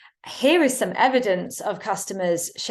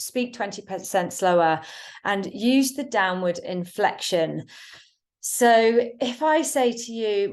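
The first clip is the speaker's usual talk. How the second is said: ordinary delivery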